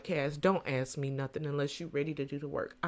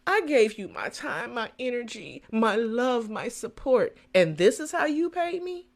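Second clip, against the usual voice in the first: high, hurt complaint; wounded, pleading for gratitude; 5 semitones higher than usual. Retold or imitated. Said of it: "I'm like".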